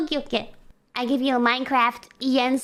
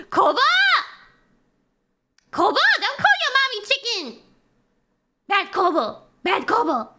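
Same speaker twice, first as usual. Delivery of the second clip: mock scolding; 7 semitones higher than usual. Mock scolding her oshi.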